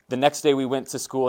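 ordinary talk

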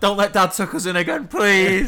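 put-on high, loud pleading child voice; scared kids. The man's kids begging not to be tucked in, high pleading child voice. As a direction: beg like a frightened little kid. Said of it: "They're like".

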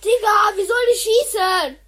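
loud, high cry of exasperation; frustrated yell. Real, not acted. Kid yelling at the friend's phone that won't shoot. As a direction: shout it at the broken game.

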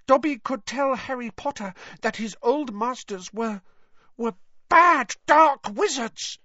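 halt, then blurt it out bravely; daring Dobby elf. Acted. Dobby dares criticise his old masters, high and building.

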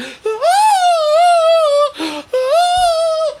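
high, loud startled gasp; mock horrified shriek-gasp; shocked gasp. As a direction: gasp like she just saw a body hit her window. Lady sees window smash.

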